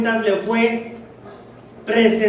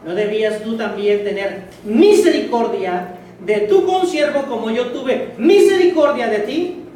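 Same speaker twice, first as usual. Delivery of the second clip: angry master; 3 semitones higher, 6 dB louder than usual. Acted. Master rebuking the wicked servant, loud and angry. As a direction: scold the ungrateful servant.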